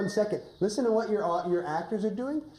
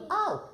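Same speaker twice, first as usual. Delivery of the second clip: high yelp of pretend pain; a quick silly ouch for the kids; mock ow; 12 semitones higher than usual. Shown as a demonstration. Teacher demonstrating a pricked finger, high playful ow. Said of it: "Can you say ow".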